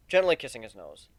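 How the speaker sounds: ordinary delivery, nothing marked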